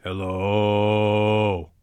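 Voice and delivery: very low hello, hold out the O; low held hello. Shown as a demonstration. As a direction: rumble a long low hello. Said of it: "a low, rumbling voice".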